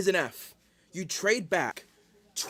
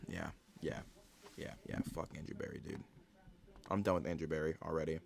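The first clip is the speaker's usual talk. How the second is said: dejected mutter; 10 semitones lower, 12 dB quieter, faster than usual. Real, not acted. Dejected after the draft pick.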